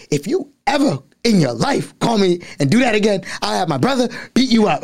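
high-pitched mock threat from the girl; girl on the phone warning him off; imitating the annoyed girl. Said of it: "she was like".

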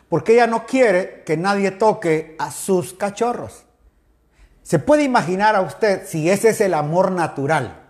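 ordinary talk, nothing marked

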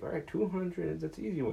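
high, put-on teacher voice explaining chocolates; mock a math teacher in a high voice. Teacher explaining chocolate percentages to the class, in a high mock voice.

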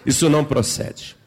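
loud, emphatic sermon delivery; forceful preaching. Real, not acted. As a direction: drive it home to the congregation.

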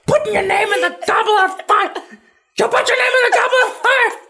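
very high hammed-up scolding; screechy comic tirade; mock furious shout. Dumbledore berating Harry before all students, mock furious screech. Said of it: "It doesn't say that he's like".

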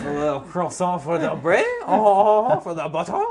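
high, loud, hammed-up French; French accent. Shown as a demonstration. Joining in the French accent game, high mock French accent. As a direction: ham up the French with chortles. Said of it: "let me hear a French accent".

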